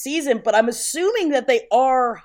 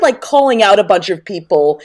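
ordinary talk

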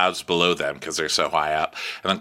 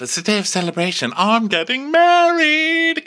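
mock elation; 4 dB louder than usual. Prince announcing his wedding, mock elation.

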